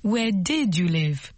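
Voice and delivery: slow past-tense question; slow model phrase. Shown as a demonstration. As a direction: say it slowly, leaning on did. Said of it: "Où habitiez-vous?".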